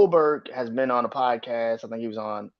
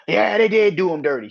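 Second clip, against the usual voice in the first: mocking fans; 6 semitones higher, 5 dB louder than usual. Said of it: "people on social media probably is like".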